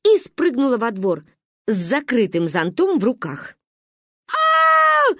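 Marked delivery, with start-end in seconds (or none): 4.3-5.1 very high, loud shriek; piercing high yell; children's scream. A narrator's reading. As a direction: scream out in alarm. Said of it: "заорали мы".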